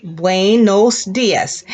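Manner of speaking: slow, clear modeling for the class; slow classroom demo; slow teacher model. Shown as a demonstration. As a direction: say it slowly so they can copy. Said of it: "good morning was".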